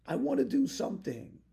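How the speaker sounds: eager. Shown as a demonstration. Repeated, louder and higher.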